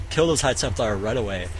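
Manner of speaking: normal talking voice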